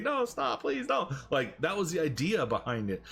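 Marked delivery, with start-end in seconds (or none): 0.0-1.1 mock pleading. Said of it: "Bret Hart gonna be like".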